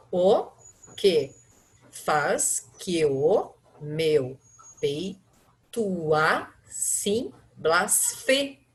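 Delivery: very slow, each syllable apart, cut at "blasfe-"; syllable by syllable count. Shown as a demonstration. Teacher counting verse syllables aloud, very slow. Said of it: "eu conto somente até aí".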